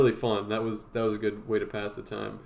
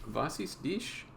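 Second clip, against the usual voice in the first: fake German; 3 semitones higher, 7 dB quieter, much slower than usual. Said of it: "doing fake German".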